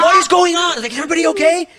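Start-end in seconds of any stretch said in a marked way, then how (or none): 0.0-0.7 very high, loud mock panic; retold freaking out. Worried friend on the phone to Ilya, freaking out. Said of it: "I was freaking out". 0.9-1.7 high, loud mock alarm; retold freaking out. Worried friend checking on the house, freaking out. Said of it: "I was freaking out".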